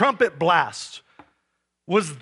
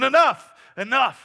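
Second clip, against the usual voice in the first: commanding cry; 7 semitones higher, 4 dB louder than usual. Pretend. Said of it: "God's going".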